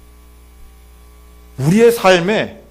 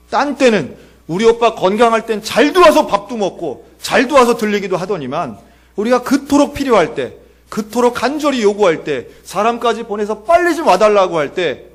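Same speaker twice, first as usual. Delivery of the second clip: raised, pressing reproach; Martha's complaint; 6 semitones higher, 4 dB louder than usual. Acted. Martha complains Jesus ignored their urgent call, pressing reproach. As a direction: pile up grievances in a higher voice. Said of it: "마르다도 한마디 하는 거예요".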